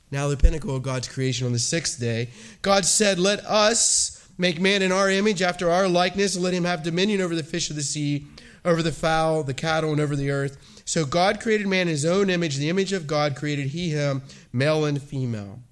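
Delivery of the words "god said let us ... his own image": ringing, proclaimed scripture reading; booming biblical narration. Acted. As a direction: thunder out each "God said". Reads Genesis creation of man.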